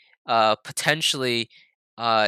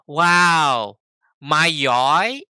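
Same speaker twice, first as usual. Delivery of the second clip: mock-impressed, drawn out and loud; sarcastic; 3 semitones higher, 5 dB louder, much slower than usual. Mom reacting to the car accident, drawn-out sarcasm. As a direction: draw each word out in mock admiration. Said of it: "Like, sarcastically".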